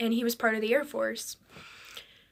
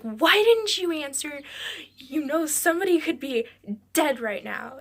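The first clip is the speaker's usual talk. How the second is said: raised, higher voice easing off at the end; retold scream; 5 semitones higher, 3 dB louder than usual. Sister scolding her brother for not answering, retold scream. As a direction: scold like a retold yell, mildly. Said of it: "I'm screaming at him".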